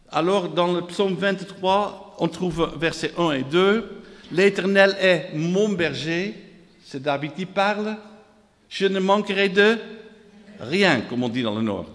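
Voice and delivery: usual speaking voice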